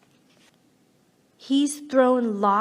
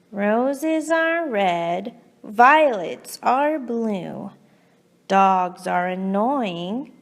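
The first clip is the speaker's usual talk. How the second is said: slow poem reading; 2 semitones lower, slower than usual. Acted. Cat's slow grudging poem.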